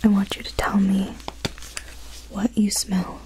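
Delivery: normal talking voice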